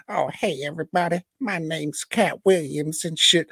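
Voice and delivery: high-pitched, badly faked impression; Cat Williams. Host badly imitating a famous comedian, high mock voice. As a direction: push the voice up high for a poor impression. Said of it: "we all know how he sounds".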